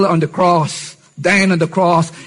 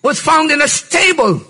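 raised, ringing sermon voice; full-force preaching; 9 semitones higher than usual. Acted. Preacher lifting the hall, high and forceful. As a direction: preach it high and strong to the congregation.

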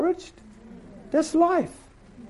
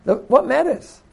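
fervent rebuttal; 6 semitones higher, 3 dB louder than usual. Retold or imitated. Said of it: "He said".

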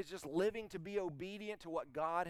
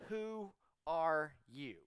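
slow, word-by-word question; direct question; much slower than usual. Acted. Pharisees' entourage questioning John, slow and direct. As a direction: draw out each word of WHO ARE YOU, evenly spaced. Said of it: "They ask this very direct question".

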